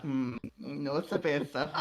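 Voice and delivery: lightly voiced posh waiter, laughing; hint of a snooty waiter. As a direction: play the snob correcting him.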